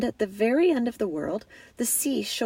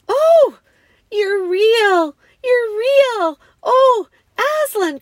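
high, loud overjoyed exclamations; joyful cry; 13 semitones higher, 12 dB louder, slower than usual. A narrator's reading. Lucy finds her lion truly alive, joyful cries. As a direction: shout it out in amazed delight. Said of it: "cried Lucy".

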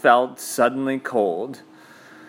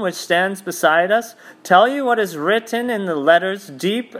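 high, rising, shrill witch voice; shriller; 7 semitones higher than usual. Acted. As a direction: push the voice up, sharp and shrill. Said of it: "her voice growing suddenly shriller".

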